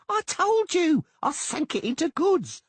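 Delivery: high, put-upon halfling voice; halfling insisting. Acted. Doppler insisting to the angry merchant, high-pitched.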